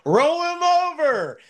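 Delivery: high, drawn-out mock scream; shrieked imitation at moderate volume. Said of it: "she literally screamed".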